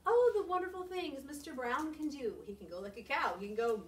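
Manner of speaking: lively picture-book reading with big sound words; expressive read-aloud. Acted. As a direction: read it to the kids with full expression.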